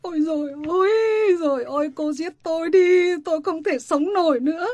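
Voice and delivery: keen as if the world has ended; wailing lament. Acted. Mother wails over news.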